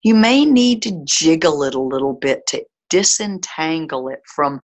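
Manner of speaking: calm, steady breathwork guidance; guided meditation. Acted. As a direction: lead the listener gently through breathing. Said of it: "leads a breathwork meditation".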